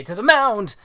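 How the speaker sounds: halting, then a loud high battle cry; stumble, then bellow the call to action; tipsy, hammed-up rallying shout; rallying drunk ballplayer. Voices drunk game character.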